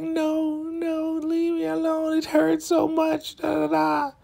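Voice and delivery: very high falsetto whine, words drawn out; exaggerated imitation of someone hurting; mock pained wailing. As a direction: wail it like a hammed-up victim. Imitates hurt Lacey begging her off.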